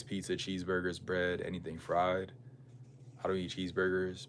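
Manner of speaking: ordinary talk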